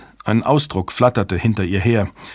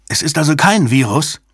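higher, hopeful questioning call; hopeful interjection; 6 semitones higher, 3 dB louder than usual. A narrator's reading. Brinkerhoff interrupts Jabba, hopeful interjection. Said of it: "rief Brinkerhoff hoffnungsvoll dazwischen".

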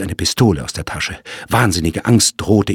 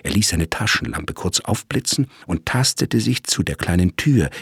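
usual speaking voice

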